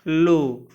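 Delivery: लो held on a pure long 'o'; a firm, drawn-out लो. Shown as a demonstration. Teacher answering how it is read, long O in लो. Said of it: "इसको क्या पढ़ते हैं?".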